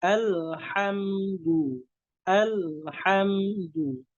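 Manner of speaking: "Alhamdu" said slowly through a smile, hamza thin; smiling thin sound. Shown as a demonstration. Teacher showing smiling "Alhamdu", slow. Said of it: "dengan tersenyum juga haknya".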